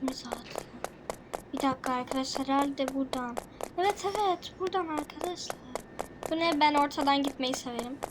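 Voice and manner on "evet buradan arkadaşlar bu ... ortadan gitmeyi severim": ordinary delivery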